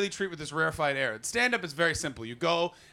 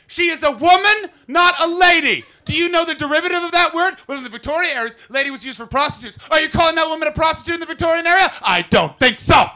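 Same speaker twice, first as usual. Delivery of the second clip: shrieking, much higher and louder rant; furious ranting shout; 13 semitones higher, 13 dB louder than usual. Retold or imitated. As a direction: blast them with outraged pedantry. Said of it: "I'm like".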